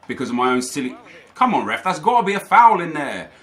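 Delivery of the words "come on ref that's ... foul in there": loud, higher protest at the referee; yelling at the ref. Real, not acted. Gamer protesting a missed foul to the referee, loud and indignant. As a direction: throw it at the ref like a real appeal. Shouts at referee mid-game.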